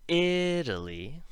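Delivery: "Italy" with a high, loud, drawn-out "I"; slow, exaggerated first-syllable stress on "Italy"; emphasized first syllable. Shown as a demonstration. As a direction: lean hard on the start of "Italy", tapping the T. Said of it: "is emphasized".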